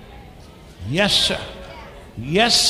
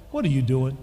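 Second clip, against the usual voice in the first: low, quieter divine interruption; voice from God; 7 semitones lower, 4 dB quieter, faster than usual. Retold or imitated. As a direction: drop the pitch as a voice answering a prayer. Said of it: "I heard something say".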